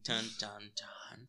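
breathy suspense whisper; whispered dun dun dun. Pretend. Whispered suspense sound effect.

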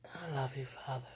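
soft tender whisper; whispered prayer. Real, not acted. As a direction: breathe it to God.

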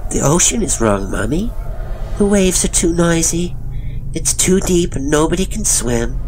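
put-on high child's voice; high-pitched childish rambling; disturbed little boy. A narrator's reading. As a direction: explain it like an odd small boy, starting high and settling.

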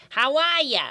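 put-on high, squawked Boston accent greeting; talking seal with a thick Boston accent; Hoover. Storyteller demonstrating the seal's greeting, in Boston accent. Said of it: "saying, like".

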